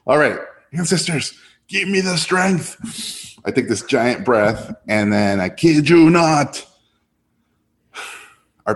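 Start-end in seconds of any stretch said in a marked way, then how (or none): 0.1-3.4 high, slow pleading to the spirits; dramatic mock prayer for strength; mock plea. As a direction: beg the ancestors half-jokingly. Pleading to ancestors before pitching.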